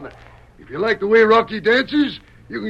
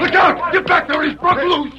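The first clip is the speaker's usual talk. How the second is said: alarmed warning; 8 semitones higher than usual. Acted. Bear breaks loose, alarm.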